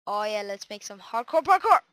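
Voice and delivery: ordinary delivery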